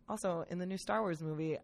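normal talking voice

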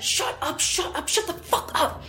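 urgent whisper through gritted teeth; scream whisper. Retold or imitated. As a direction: hiss it at her, terrified and forceful but without voice. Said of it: "she scream whispered through tightly gritted teeth".

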